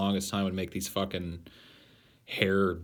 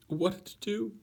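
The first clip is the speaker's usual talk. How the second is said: high-pitched; 13 semitones higher than usual. Pretend. Repeats question in high voice.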